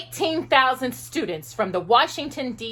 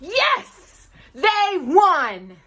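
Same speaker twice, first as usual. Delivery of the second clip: high, bright burst of joy; joyful cheer; 8 semitones higher, 4 dB louder, much slower than usual. A narrator's reading. Reading the court win to children, an excited cheer. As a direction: cheer the victory out loud.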